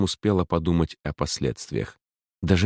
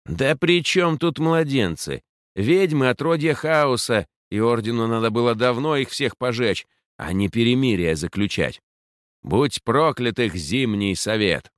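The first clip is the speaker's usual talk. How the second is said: hateful anger; 6 semitones higher than usual. Acted. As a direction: spit out pure hatred. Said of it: "говорила о ведающих с такой злобой и ненавистью".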